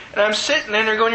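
ordinary talk

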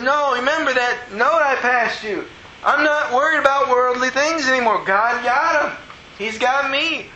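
high, fervent retold protest; excited insistence. Retold excited protest to doubting friend.

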